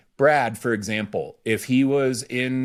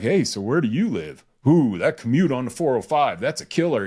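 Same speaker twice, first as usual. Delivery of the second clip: chummy small talk. Retold or imitated. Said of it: "go to a meeting and you're like".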